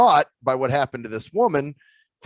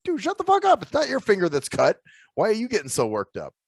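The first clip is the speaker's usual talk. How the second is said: much higher exasperated outburst; retold anger; 6 semitones higher than usual. Scolding coworker who fusses over a cut finger, mock anger. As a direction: snap at the squeamish coworker, half-mock. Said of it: "I would get mad at him".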